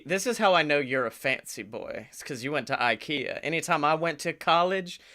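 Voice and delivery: ordinary talk, nothing marked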